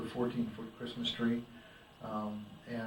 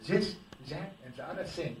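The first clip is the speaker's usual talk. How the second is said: put-on This, that, and the other thing said as Zis, zat, and ze ozzer sing, German accent, higher; mock German accent; 5 semitones higher than usual. Exchange student mimics the speaker, German accent. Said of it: "and he goes".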